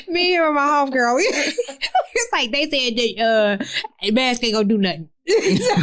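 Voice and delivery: high, breaking up with laughter; can barely get it out for laughing; laughing hard. Real, not acted. A nurse laughs at her own mask habits.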